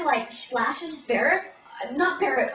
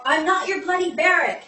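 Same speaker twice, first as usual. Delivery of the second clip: mock-indignant outburst, higher and louder; indignant retort; 5 semitones higher, 6 dB louder than usual. Man correcting her for calling him Beric, indignant. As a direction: throw it back in mild protest.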